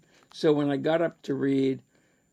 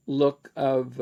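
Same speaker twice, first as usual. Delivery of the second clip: ordinary delivery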